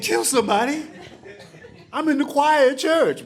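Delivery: high, squeaky alarmed voice through laughter; panicked kid. Retold or imitated. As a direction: voice jumps up in shock. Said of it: "I was like".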